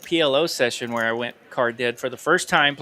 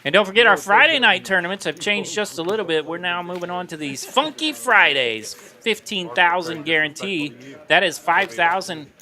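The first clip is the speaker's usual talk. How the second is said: upbeat, higher announcer promo; promo read; 4 semitones higher than usual. Acted. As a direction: sell the tournament like an ad. Casino tournament promo read.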